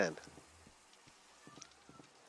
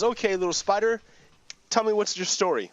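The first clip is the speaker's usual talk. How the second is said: Buddha; 7 semitones higher than usual. Acted. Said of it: "And the Buddha says".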